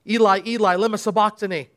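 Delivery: usual speaking voice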